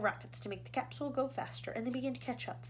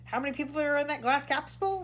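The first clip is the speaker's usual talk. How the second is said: rasped; 6 semitones higher, 6 dB louder than usual. A narrator's reading. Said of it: "rasped the President".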